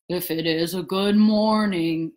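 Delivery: low, gloomy donkey voice; doubtful and glum, low; Eeyore. A narrator's reading. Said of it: "said Eeyore".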